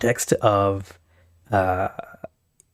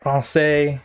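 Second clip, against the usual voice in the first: mock French accent; 3 semitones higher, much slower than usual. Mock French phrases at anthem.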